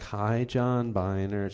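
slow, drawn-out flirty greeting; playfully flirty. Retold or imitated. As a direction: imitate her teasing flirtation, lingering on the name. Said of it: "she was flirting with him".

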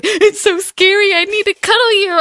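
put-on high, fast whiny boyfriend voice; whiny ex impression. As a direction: whine it like a scared boyfriend. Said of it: "He's like".

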